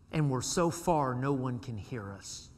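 normal talking voice

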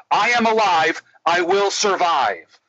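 high, emphatic declaration; confident affirmation. Retold or imitated. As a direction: imitate a client gaining conviction. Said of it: "Now say it all together".

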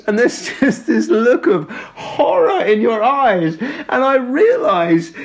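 slow, laughing through the words; can barely get it out for laughing; retelling the story while cracking up. Real, not acted.

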